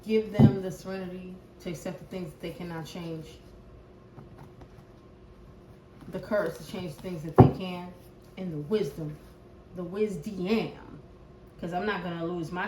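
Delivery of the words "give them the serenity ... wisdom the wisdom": low, soft prayer voice, quicker on the long lines. Acted. Reader praying softly over the collective before the reading. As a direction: pray quietly to God and the ancestors for the collective. Prays for the viewers.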